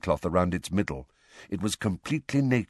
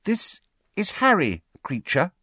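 high-pitched, careful introduction; tentative; 11 semitones higher than usual. A narrator's reading. Hermione introducing Harry to the elf, tentative. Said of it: "said Hermione tentatively".

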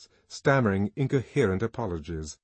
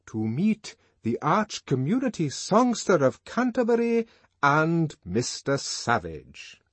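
proclaiming triumph; 7 semitones higher, 5 dB louder, slower than usual. A narrator's reading. Invitation card proclaimed.

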